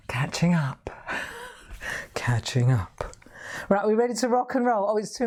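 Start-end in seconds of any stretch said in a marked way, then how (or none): 0.1-3.2 guilty whisper. Real, not acted. Guilty joke about chocolate.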